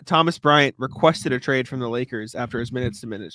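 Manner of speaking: normal talking voice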